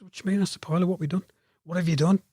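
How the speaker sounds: mock-baffled, pitch lifted; retold bafflement. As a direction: act out mild alarm at being in trouble. Retold alarm at being held back.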